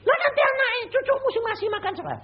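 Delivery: high, loud mock scolding voice; retold scolding. Scolding a patient about his children, high and loud.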